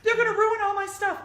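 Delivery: hammed-up complaint; mock whine. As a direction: whine like a fretful customer. Mocking fear of change.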